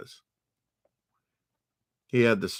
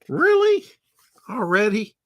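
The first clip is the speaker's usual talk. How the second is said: much higher, louder "really?" in disbelief; incredulous; 12 semitones higher, 6 dB louder than usual. Real, not acted. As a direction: scoff at the story in mock shock. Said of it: "reacting to the story's cheating".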